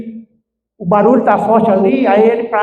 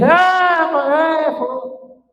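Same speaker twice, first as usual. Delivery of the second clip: high, drawn-out mock yell; long wailed cry that fades away; mock shouting; 9 semitones higher, 4 dB quieter, much slower than usual. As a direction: imitate people hollering, pitch way up, volume held back. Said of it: "A gente grita".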